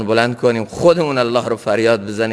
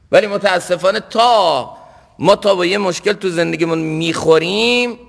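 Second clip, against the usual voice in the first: ordinary talk, nothing marked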